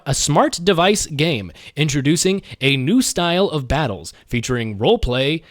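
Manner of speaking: ordinary talk, nothing marked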